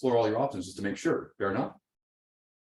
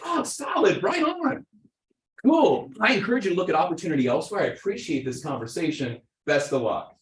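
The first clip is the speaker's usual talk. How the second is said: mock polite brush-off; 7 semitones higher, 4 dB louder than usual. Agent politely turning client away.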